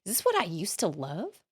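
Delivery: mock-confused questioning, starting very high; puzzled, disbelieving reaction retold. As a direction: ask in high-pitched bafflement, then settle. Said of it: "I was really confused".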